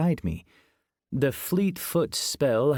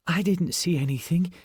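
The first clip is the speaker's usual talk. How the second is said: panting; 6 semitones higher than usual. A narrator's reading. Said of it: "Ashley panted".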